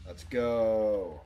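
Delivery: loud, punchy rally cry; big energetic yell; hyped shout. Real, not acted. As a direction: shout it to get going. Streamer hyping himself up.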